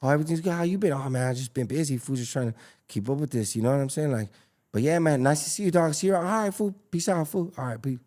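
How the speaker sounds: high-pitched, warm, buddy-buddy greeting; friendly homie greeting. Retold or imitated. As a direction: play it up like a long-lost friend. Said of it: "You come at someone like".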